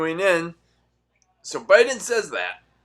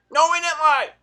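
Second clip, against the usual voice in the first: mock whiny reply; 12 semitones higher, 4 dB louder than usual. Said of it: "mock exchange".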